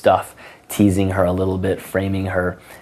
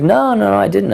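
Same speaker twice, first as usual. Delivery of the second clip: much higher, put-on girl's voice; imitated girl's denial; 13 semitones higher than usual. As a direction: protest high, imitating a girl.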